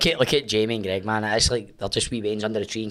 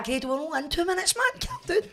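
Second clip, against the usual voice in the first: high-pitched calming; 4 dB quieter than usual. Retold or imitated. Teller's own reply imitated.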